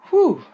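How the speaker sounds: high loud relieved whew; a big sigh of relief. Acted. Relieved rabbit exhales.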